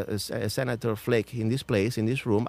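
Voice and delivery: usual speaking voice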